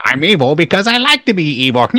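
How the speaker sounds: lightly voiced cartoon villain; a hint of a gloating villain; Skeletor voice. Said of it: "if you ask Skeletor".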